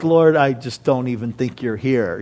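low, deflated admission to God; doubting prayer. Retold or imitated. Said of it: "other times where I'm like".

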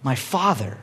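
low, soft acted grief; hushed, remorseful voice; grieving. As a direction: murmur it with regret. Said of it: "grieves what he just did".

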